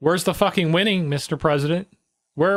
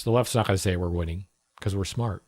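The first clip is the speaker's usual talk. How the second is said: normal talking voice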